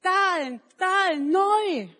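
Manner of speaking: put-on high, loud childlike cry; simple boy shouting about his sandals; Manni's excited call. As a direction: blurt it out with joy. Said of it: "Und Manni sagt dann plötzlich".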